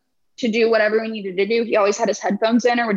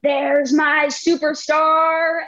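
slow, higher and drawn-out praise; imitating the principal; 4 semitones higher, much slower than usual. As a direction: stretch it out like a beaming principal. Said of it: "he'd always be like".

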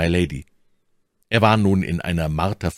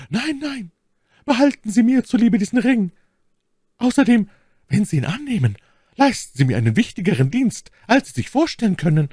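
much higher, emotional lady's voice; moved voice; 4 dB louder than usual. Acted. My Lady urges the count to keep her ring, moved voice. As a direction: press the ring on him, stirred. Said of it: "mit bewegter Stimme".

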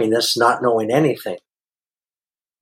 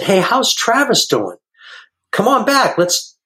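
cheery greeting; 9 semitones higher than usual. Retold or imitated.

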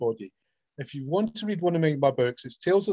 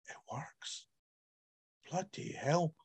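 awed whisper; 13 dB quieter, slower than usual. Retold or imitated. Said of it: "she just read it and I went".

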